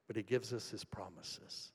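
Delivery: usual speaking voice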